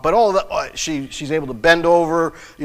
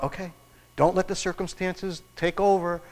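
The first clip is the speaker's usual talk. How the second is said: inner self-talk; 9 dB quieter than usual. Retold or imitated. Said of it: "thinking about".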